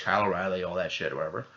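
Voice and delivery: ordinary talk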